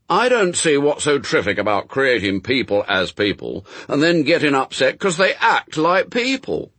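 put-on loud, severe boy's protest. A narrator's reading. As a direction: scold the grown-ups as an indignant child. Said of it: "said Adam severely".

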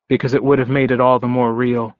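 ordinary delivery

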